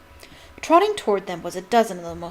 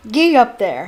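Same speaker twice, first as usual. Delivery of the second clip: loud, bluff roar; 3 semitones higher, 9 dB louder than usual. A narrator's reading. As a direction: holler the beasts forward. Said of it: "he roared".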